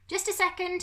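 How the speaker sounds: high, loud call. A narrator's reading. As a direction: call it to the door. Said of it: "he called".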